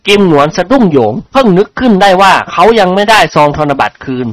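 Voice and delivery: ordinary delivery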